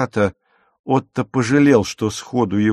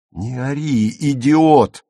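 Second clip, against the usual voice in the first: loud sharp rebuke at his brother; angry hiss that bursts out loud; hissed scolding; 4 semitones higher, 5 dB louder, slower than usual. A narrator's reading. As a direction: cut him off angrily. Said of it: "прошипел Пауль".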